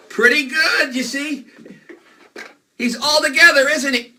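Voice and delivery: high, loud, bright cheer; excited cheer. Acted. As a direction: cheer it out big for the kids. Magician cheers the rebuilt bunny.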